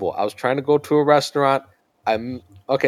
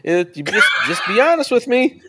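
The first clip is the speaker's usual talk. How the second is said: high, fast, coaxing then laughing; hammed-up guard pleading for honesty; border guard; 9 semitones higher than usual. Dad mocking the border guard asking about a gun, high and laughing. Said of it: "He's like".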